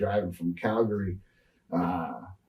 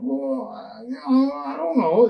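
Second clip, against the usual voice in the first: much higher, sheepish put-on voice; sheepish excuse; 3 dB louder than usual. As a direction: squeak out a lame excuse. Caught with shotgun in trunk.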